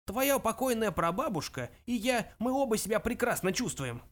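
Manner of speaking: put-on high, creaking elderly voice; old man's high, querulous creak; creaky old man. A narrator's reading. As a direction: creak it out like an ancient ghost. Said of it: "проскрипел старик".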